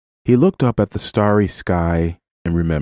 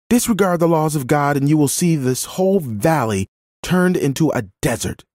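higher-pitched, urgent pleading of the law; impassioned protest; 9 semitones higher than usual. A narrator's reading. Woman defending a gleaning orphan to the landowner, impassioned. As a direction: argue earnestly on the child's behalf.

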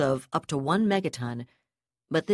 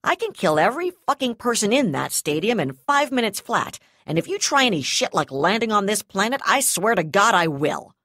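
loud, higher, furious threat; 5 semitones higher, 6 dB louder than usual. Acted. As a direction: snarl the threat like she means it. Hostage-taker threatens over comm.

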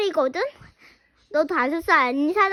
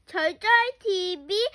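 ordinary talk

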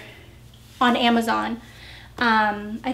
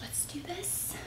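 breathy whispered pep talk; 5 semitones higher, 23 dB quieter than usual. Real, not acted. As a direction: whisper it to yourself. Psyching up before lifting box.

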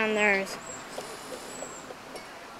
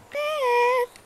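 yelling out to a friend off the cliff; calling out; 11 semitones higher than usual. Acted. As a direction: shout his name after him as he falls. Calling after friend who fell.

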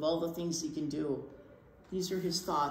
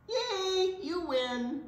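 cheerful yay; 11 semitones higher, much slower than usual. Acted. Norman cheers in tree game.

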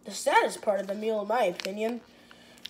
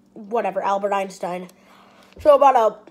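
sucking yogurt tube; mouth on yogurt tube; 3 semitones lower, 6 dB louder, faster than usual. Real, not acted. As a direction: lips around tube. Seen 2: Tube in mouth only at start; removed by 0.6s, then gesturing.

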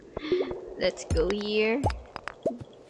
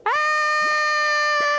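startled shriek. Real, not acted. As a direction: shriek when the slime jumps you. Slime attacks in the game.

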